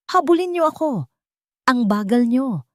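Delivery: put-on high, loud little boy calling out; playful child shout. A narrator's reading. Boy calling his parents on the beach, loud and gleeful. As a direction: tease them to chase you, shouting. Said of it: "Sigaw ni Matias".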